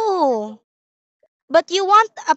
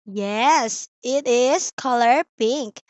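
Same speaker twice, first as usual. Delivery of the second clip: ordinary delivery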